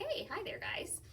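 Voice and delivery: cheery little pig saying hi; pig's greeting. Acted. The pig greeting the prince and horse, high and cheery. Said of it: "The pig's like".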